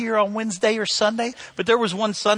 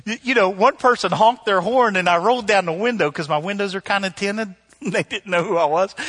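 usual speaking voice

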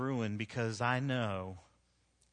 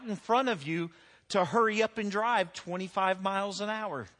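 higher, louder preaching with a driving beat; full-force preaching; 8 semitones higher, 4 dB louder than usual. Acted. Preacher warning the congregation about anger, at full force. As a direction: preach it hard from the pulpit.

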